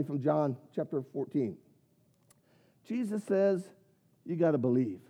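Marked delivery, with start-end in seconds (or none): none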